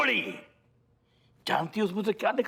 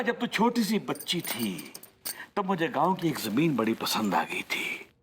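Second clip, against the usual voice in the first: normal talking voice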